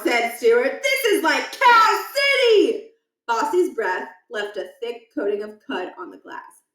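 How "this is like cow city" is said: high, loud, slowed glee; excited kid. Acted. Boy marveling at cows in the school, excited. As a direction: revel in the chaos. Stuart delighted.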